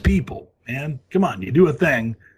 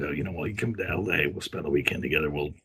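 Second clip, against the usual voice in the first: lower smooth voice; 9 semitones lower, 3 dB quieter than usual. Retold or imitated. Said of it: "and I said".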